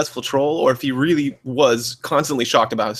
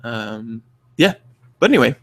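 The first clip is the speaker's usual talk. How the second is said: ordinary delivery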